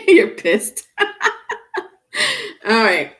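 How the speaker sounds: laughing through the words. Real, not acted. Still laughing at viewer.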